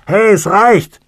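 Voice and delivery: high, loud shout from inside a coffin; full-voiced, high-pitched holler; angry shout. A narrator's reading. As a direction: yell in protest to be let out. Trapped man hammers on coffin lid.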